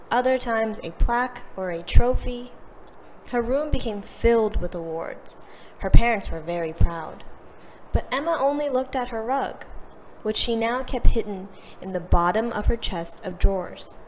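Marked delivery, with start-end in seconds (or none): none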